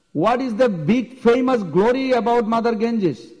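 high-pitched proud challenge; hammed-up haughty boast; arrogant boasting. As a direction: scoff as if far above it all. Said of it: "very arrogant, very proudly".

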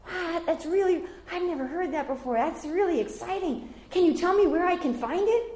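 high, gushing feigned wonder; mock enthusiasm. Shown as a demonstration. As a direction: pretend to be thrilled, then ask. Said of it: "or you can even say it".

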